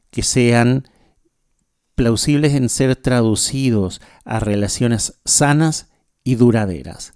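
ordinary talk, nothing marked

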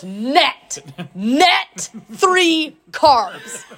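slow, hammed-up indignant correction; emphatic insistence. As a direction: drag out each word as if proving a point. Client indignantly points at package label.

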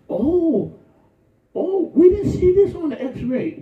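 high, laughing put-on doctor voice; preacher mimicking a doctor finding something, high and amused; mock doctor. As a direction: ham up the surprised doctor.

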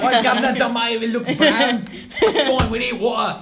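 loud, high, hammed-up British accent; over-the-top mock British holler; bastardized British accent. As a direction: shout it in a terrible British accent, half laughing. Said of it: "it was a terribly bastardized British accent".

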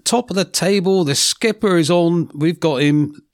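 radio host intro. Acted. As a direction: announce the guest like a radio host.

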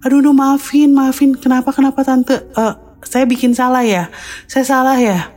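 high, rushed, panicky apologizing; alarmed, high-pitched "aduh, maafin"; flustered panic. Retold or imitated. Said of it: "Kaget banget kan?".

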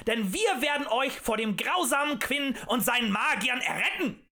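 high, loud rallying cry; fervent demagogue's shout; full-throated roar. A narrator's reading. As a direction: bellow a call to join the cause. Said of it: "brüllte er aus voller Kehle".

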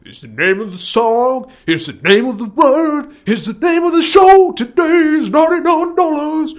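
rising hyped-up shout; excited announcer. Real, not acted. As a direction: hype it up like a radio promo. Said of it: "I'm just a little bit excited about broadcasting".

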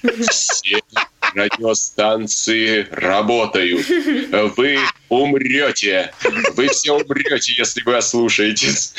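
slow, solemn Soviet radio announcer; Levitan announcer. Pretend. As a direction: boom it out like a wartime broadcast. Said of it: "Левитан там говорит".

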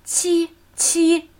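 "qi" held high and perfectly level; high, even tone. Shown as a demonstration. Teacher demonstrating the number seven, high even tone. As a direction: keep each "qi" up high without moving the pitch. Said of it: "Erste hohe, gleichmäßige Ton".

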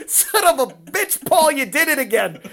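loud, laughing "son of a bitch" in half-mock despair; exasperated laughing outburst. A coach watching his lifter's bad number, exasperated loud laughing curse. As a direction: blurt it out, laughing and cursing at once.

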